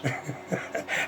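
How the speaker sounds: short unvoiced laugh, mostly air; quiet breathy chuckle; breathy scoffing laugh. Real, not acted. Mocking laugh.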